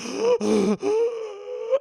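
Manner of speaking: high, loud choking gasp; pained gasp. Retold or imitated. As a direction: gasp as the ribs cave in. Victim's bones caving in.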